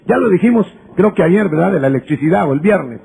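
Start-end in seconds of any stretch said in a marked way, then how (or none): none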